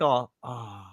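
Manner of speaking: low, soft letdown, acted out; deflated agents. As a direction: imitate crestfallen investigators. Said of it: "all deflated".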